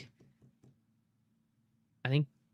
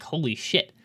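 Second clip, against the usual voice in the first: mock shock; 2 semitones higher, 5 dB louder, slower than usual. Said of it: "and I'm like".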